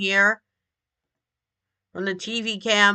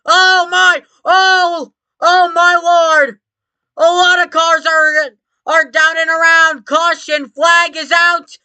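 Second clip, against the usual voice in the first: motorsport commentator; 6 semitones higher, 5 dB louder than usual. Acted. Live race play-by-play after crash.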